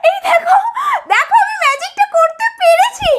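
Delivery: high, elated shouting; excited triumph. Acted. New genie shows off working magic, excited shout.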